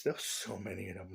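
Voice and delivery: ordinary talk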